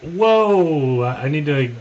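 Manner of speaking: sudden high loud whoa; playfully startled exclamation; mock alarm. Cohost teasing the host's on-air F-bomb, mock shocked.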